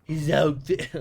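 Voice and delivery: slow, drawn-out Trump voice; Trump impression. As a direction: complain like Trump at a podium. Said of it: "I can't do a Trump impression".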